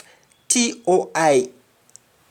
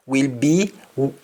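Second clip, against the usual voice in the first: ordinary talk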